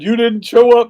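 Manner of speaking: high, loud mock-crying protest; imitated tearful complaint. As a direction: mimic someone wailing in tears. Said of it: "she's in tears, crying".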